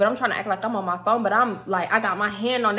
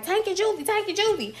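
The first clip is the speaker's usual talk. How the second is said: much higher sing-song hail across the lot; calling out; 11 semitones higher than usual. Retold or imitated. Passersby keep hailing Tank and Juvie, high calling out. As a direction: shout their names like a passing fan.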